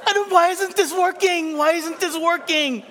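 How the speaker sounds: high-pitched hammed-up wail; mock crying. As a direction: bawl comically like a baffled child, voice way up. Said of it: "And I began to cry".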